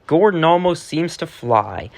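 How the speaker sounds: ordinary delivery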